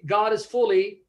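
normal talking voice

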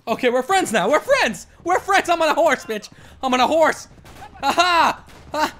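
high, loud, giddy boasting; gleeful shouting. Real, not acted. Gamer telling enemies we're friends now, gleeful shouting. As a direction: crow it out in rising excitement. Elated at new allies and horse.

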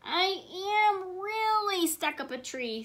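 high, slow, drawn-out stress on "really"; emphatic insistence. A narrator's reading. Wilbur insists to disbelieving elephants, high slow stress on "really".

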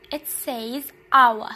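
careful teacher model of hour. Shown as a demonstration. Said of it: "a careful pronunciation of the word hour".

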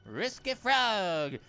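slow, drawn-out mock French; loud, lingering French-accented name. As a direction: draw it out with a playful French accent. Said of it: "I went to a French accent".

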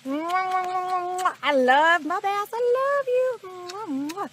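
slow, high cooing to the fish; baby talk. Pretend. Angler kissing his bass, slow high baby talk. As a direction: coo at it like a baby.

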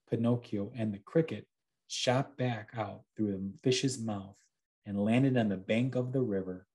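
ordinary delivery

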